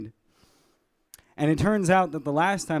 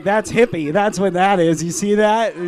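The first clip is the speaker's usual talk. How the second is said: loud, higher-pitched showing off; mock inmate boasting; 6 semitones higher, 7 dB louder than usual. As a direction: play a hammed-up prisoner parading his prize. Acts out inmate showing him off.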